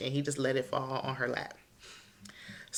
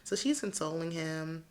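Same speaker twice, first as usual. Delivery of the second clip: ordinary delivery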